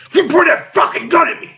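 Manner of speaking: high, loud angry yell; retold furious shout at the brother. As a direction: scream it at your brother with a gun in his hand. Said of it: "I'm like".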